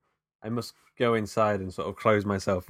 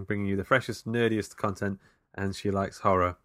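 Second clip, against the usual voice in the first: usual speaking voice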